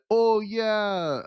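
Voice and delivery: half-hearted stab at the catchphrase; wrong meme attempt; botched meme. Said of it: "I didn't get the meme right".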